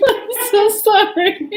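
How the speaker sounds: can't stop laughing while apologising; giggling. Real, not acted. Reading teacher gets the giggles and apologises, laughing. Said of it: "I have the giggles today".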